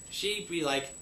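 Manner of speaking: ordinary delivery, nothing marked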